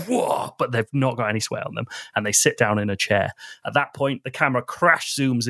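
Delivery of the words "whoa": high startled whoa; mock surprise. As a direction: fake being caught off guard. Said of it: "it looks like".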